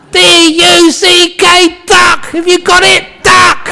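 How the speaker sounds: high-pitched yell, spelling letter by letter; exasperated shout. Acted. Husband spelling duck out for his wife, shouting. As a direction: hammer out each letter at full volume.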